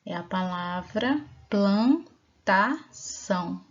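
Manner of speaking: slow, word by word, modelling the reading; slow careful reading. Shown as a demonstration. Teacher modelling the word for children, slow and clear. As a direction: spell it out slowly for the class. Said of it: "Alguém consegue ler?".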